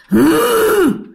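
put-on high, loud rasping gasp of an old woman; a ghostly old woman's hự. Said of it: "giọng của giống như nữ mà già". (tiếng hự cao và to của bà già ma)

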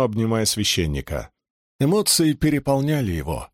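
usual speaking voice

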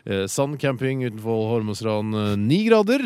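loud, high-pitched DJ back-announcement; big upbeat presenter voice; radio DJ announcing. Acted. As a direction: project it like a summer radio host. Radio host back-announces song, reads temperatures.

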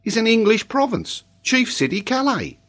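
high-pitched, matter-of-fact pupil; unruffled schoolboy reciting; composed child. Acted. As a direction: state it calmly in a high child's voice. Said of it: "said Tolini quietly".

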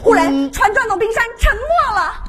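hammed-up alarm; dramatic loud narration. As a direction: call out the sinking like a disaster movie. Dramatic sinking.

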